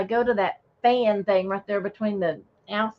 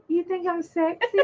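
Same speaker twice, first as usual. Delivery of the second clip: sexy voice; 6 semitones higher than usual. Pretend. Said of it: "She got that sexy voice going".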